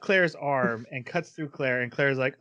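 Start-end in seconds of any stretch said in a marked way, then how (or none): none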